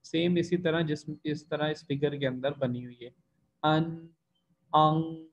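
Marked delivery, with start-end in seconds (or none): 3.6-5.3 अन with tongue tip, अंग ending back in the throat; an vs ang contrast. Shown as a demonstration. Teacher contrasting अन and अंग for students. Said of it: "अन और अंग में ये फर्क होगा".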